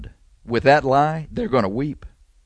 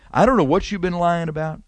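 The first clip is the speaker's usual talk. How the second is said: ordinary talk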